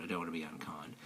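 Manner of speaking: a sheepish whispered aside; a guilty little whisper after a cheeky joke. Real, not acted. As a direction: drop to a breathy whisper, half to yourself. Teasing aside, then retracting.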